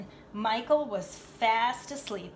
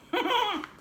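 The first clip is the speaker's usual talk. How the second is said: laugh; 11 semitones higher, 5 dB louder than usual. Real, not acted. Laughs at own reading.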